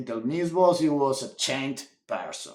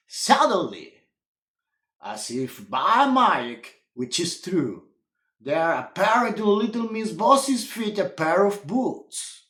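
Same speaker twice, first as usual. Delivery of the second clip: ordinary delivery, nothing marked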